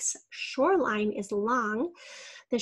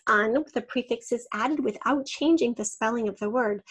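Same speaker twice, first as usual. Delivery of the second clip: usual speaking voice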